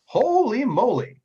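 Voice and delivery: high, loud, drawn-out amazement; amazed outburst. Real, not acted. Game master reacting to a double critical roll, amazed. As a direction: stretch it out in loud astonishment.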